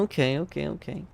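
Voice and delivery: normal talking voice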